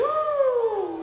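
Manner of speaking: loud high whoop; cheering whoop. Real, not acted. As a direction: let out a big whoop. Cheering with crowd.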